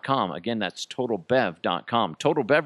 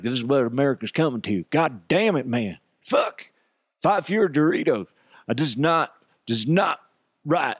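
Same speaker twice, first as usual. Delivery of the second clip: mock outraged citizen, pitched up; pissed-off complainer; 3 semitones higher than usual. Imitating people pissed at fewer Doritos, mock angry. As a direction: play someone angrily griping about America. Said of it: "there's people that are pissed at this".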